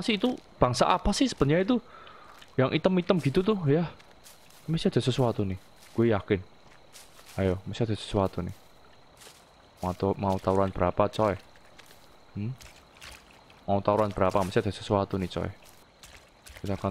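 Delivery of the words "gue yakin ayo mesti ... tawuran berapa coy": ordinary talk, nothing marked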